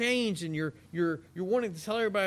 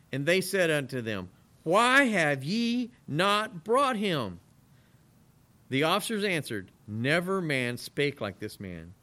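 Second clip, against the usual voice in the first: scripture reading; 6 dB louder than usual. Acted. Reads scripture aloud.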